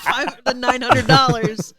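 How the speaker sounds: real laughing. Real, not acted. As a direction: laugh through the words. Joking boast amid group laughter.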